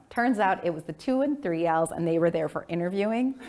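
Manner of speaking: ordinary talk, nothing marked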